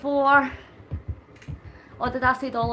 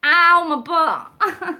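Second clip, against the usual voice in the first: loud slow complaining ow; loud pained ow; 6 semitones higher, 7 dB louder, much slower than usual. Real, not acted. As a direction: yelp at a sore butt. Sore from cycling.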